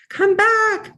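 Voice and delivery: high, loud playful call. Pretend. Host to the book image that went away, calling it back. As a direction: sing out after something slipping away. Calls to vanished book cover display.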